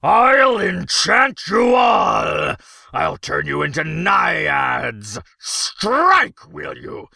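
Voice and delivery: loud, high, slow-wound ranting; furious threats. A narrator's reading. Emperor threatening the strikers with magic, furious rant. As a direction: rant and threaten, building to a roar. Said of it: "inarticulate with fury".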